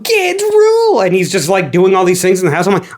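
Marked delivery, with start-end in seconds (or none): 0.0-1.0 put-on much higher, slow, whiny kid voice; mock kid Sonic. Mocking Sonic celebrating no parents, squeaky high kid voice. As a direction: play a hyper little kid left home alone. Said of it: "He's like".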